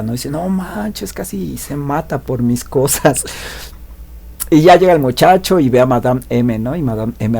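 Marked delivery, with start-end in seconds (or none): 0.3-3.2 soft, slow and shaken; frightened blonde. Retold or imitated. Blonde after nearly getting him killed, quiet and shaken. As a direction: hush it out, a touch scared. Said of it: "bien espantada".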